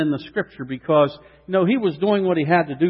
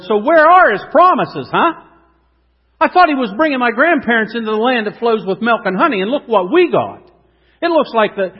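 higher and louder, pressing hard; indignant complaint; 4 semitones higher, 5 dB louder than usual. Acted. Gideon confronting the angel of the Lord, loud and indignant. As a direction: demand answers from heaven.

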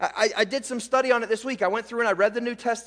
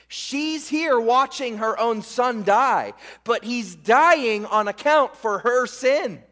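slow, emphatic preaching with long pauses; slower than usual. Acted. Pastor to church, stressing Mary needed saving, slow and emphatic. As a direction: drive each point home slowly.

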